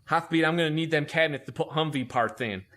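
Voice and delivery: exaggerated lisp, imitating the chief; bad lisp. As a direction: lisp the s sounds thickly. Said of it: "talked with a really bad lisp".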